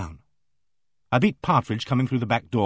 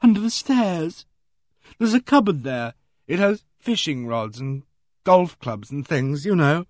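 trembling; 5 semitones higher than usual. Acted. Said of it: "The trembling grew".

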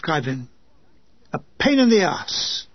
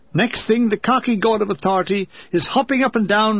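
ordinary delivery, nothing marked

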